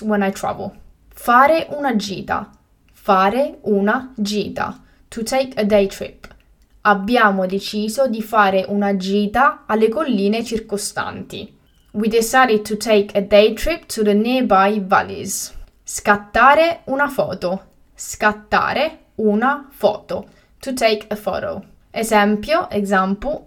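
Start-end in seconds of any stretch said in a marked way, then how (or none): 2.9-4.8 slow and carefully spaced; slow, clear repetition. Shown as a demonstration. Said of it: "teacher repeats the word twice". 17.9-20.3 slow and carefully spaced; slow, clear repetition. Shown as a demonstration. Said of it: "teacher repeats the word twice".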